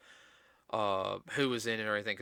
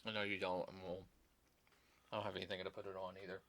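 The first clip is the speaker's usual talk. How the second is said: talking while chewing gum; 2 semitones lower, 12 dB quieter, faster than usual. Real, not acted. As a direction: gum in the mouth. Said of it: "my owl's got gum in my mouth".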